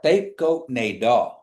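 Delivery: slowly, each word separated; slow model phrase. Shown as a demonstration. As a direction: say "They go nay dog" slowly, word by word. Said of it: "Just practice that last part".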